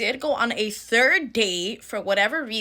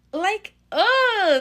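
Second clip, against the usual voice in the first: a loud, high groan of disgust; grossed-out ugh; 11 semitones higher, 6 dB louder than usual. Real, not acted. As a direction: let the ick out loud. Disgust at his cheap date.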